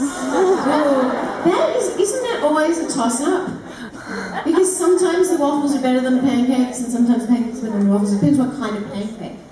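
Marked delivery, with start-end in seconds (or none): 0.0-6.2 amused, higher laughing reply. Real, not acted. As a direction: laugh as you answer. Host laughs at question.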